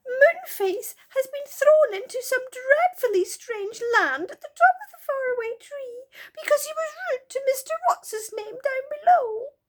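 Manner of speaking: high, loud sobbing little fairy. Acted. Silky sobs to the children about Moonface, high and tearful. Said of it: "sobbed Silky".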